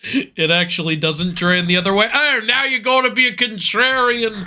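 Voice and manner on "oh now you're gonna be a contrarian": higher-pitched mock-angry character rant; ranting put-on voice. Scolding friend as a contrarian, hammy mock rant. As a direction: launch back into the hammy indignant voice. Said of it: "I love doing that voice".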